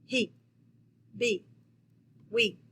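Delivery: he, be, we, each as one firm stressed beat; strong accent. Shown as a demonstration. Teacher showing accented one-syllable words, strong stress. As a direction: say "he", "be", "we" strongly, each standing alone. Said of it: "that's kinda how strong you say that word".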